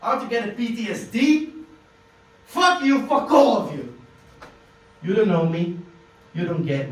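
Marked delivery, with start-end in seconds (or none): 0.0-1.5 bitter rant. Acted. Angry rant at the class. 2.5-4.0 higher, louder furious outburst at everyone; furious shout. Acted. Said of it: "I got a bit emotional".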